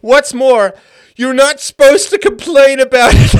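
loud, high, barely holding it; laughing while reading. Real, not acted. Host forcing out the quote, laughing. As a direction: push the words through the giggles.

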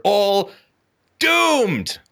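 high, drawn-out cry of doom; mock dire proclamation. Warning men what happens otherwise, hammed-up cry of doom. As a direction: wail it high, then let it fall.

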